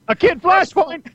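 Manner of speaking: put-on kid Flash. Tiny Flash showing off his pencil, high and proud. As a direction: announce it like a proud tiny hero. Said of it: "tiny little Flash with his sharpened pencil".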